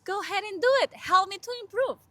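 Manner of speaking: put-on loud and upbeat, daring the class to laugh at her accent. TA telling students to laugh at her accent, loud and game. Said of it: "I even tell them".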